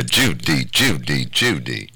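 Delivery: slow, low movie-star catchphrase with a beat between repeats; Cary Grant. Acted. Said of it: "if that's a good impression of him".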